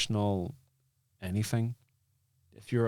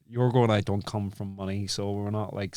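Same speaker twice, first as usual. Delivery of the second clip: ordinary talk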